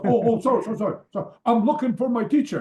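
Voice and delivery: high, loud opening, tumbling apologies; flustered apology. Retold or imitated. Caller looking for his teacher, acted fluster. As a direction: imitate a flustered caller backpedalling.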